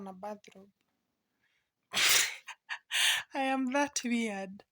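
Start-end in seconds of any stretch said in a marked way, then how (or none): 1.9-3.3 loud burst of laughter; real laugh. Real, not acted. Laughs at own weirdness.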